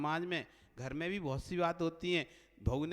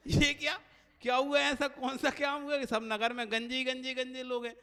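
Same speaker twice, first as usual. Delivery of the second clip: shocked; 7 semitones higher, 4 dB louder than usual. Acted. Minister shocked at bald city.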